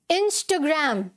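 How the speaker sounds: "Instagram" as in-stuh-gram; corrected pronunciation. Shown as a demonstration. Teacher repeats it for students, "Instagram" as in-stuh-gram. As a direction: shorten the middle of "Instagram" to a rounded stho. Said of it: "क्या बोलना है?".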